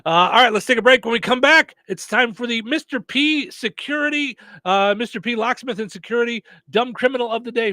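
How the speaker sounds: high, projected host voice; radio segment tease. Real, not acted. Host sending listeners to a break, upbeat announcing.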